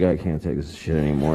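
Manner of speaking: low, defeated collapse into a groan; exhausted parent. Retold or imitated. Frazzled mom slumping in McDonald's, voice giving out. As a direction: sag like a worn-out mom giving up. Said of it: "the parent's like".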